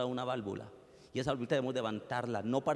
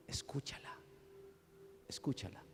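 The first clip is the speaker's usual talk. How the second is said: fading into a whisper; whispered inner voice; 14 dB quieter than usual. Retold or imitated. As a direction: let the voice of God dissolve into breath. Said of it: "una voz que me dijo".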